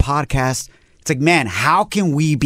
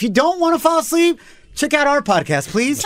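playful plug; 9 semitones higher than usual. Acted.

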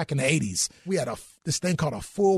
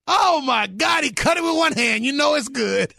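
much higher, loud amazed exclamation; excited shout; 9 semitones higher, 4 dB louder than usual. Retold or imitated. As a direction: shout it out, blown away by the prime rib. Retelling his own excited reaction.

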